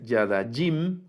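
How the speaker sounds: "yadajim" stressed on its last syllable; wrong final stress. Shown as a demonstration. Teacher showing students the mistake, "yadajim" stressed on the final syllable. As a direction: put the accent at the very end of "yadajim". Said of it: "con el acento hasta el final".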